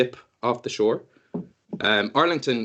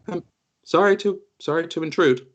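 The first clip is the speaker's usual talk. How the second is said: higher, dreamy and distracted; absent-minded creature; 5 semitones higher, 3 dB louder than usual. The glowing creature apologizes to the party, absent-minded and high. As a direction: speak as if half elsewhere. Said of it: "this creature turns around to you".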